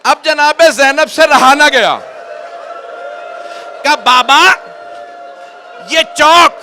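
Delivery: loud, high, wailing preacher's lament; rising grief-stricken sermon, loud and slow; majlis recitation at full force. Acted. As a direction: cry the lament out to the mourners.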